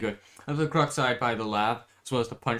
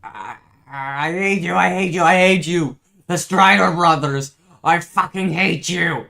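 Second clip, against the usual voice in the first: angry crying; 7 semitones higher, 13 dB louder, slower than usual. Acted. Villain's hateful outburst.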